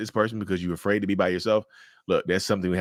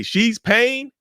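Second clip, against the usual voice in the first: shrill mock announcement, pitched way up and louder; mocking sneer; 6 dB louder than usual. Host mocks the boyfriend announcing she's paying, high sneering jab. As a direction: jeer it out like him showing her up.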